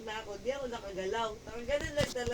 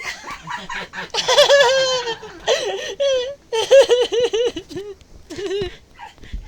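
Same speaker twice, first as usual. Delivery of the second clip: loud shrieking laugh, almost crying; howling with laughter; laughing till tears; helpless laughter; 11 semitones higher, 15 dB louder than usual. Real, not acted. Cracking up at the story.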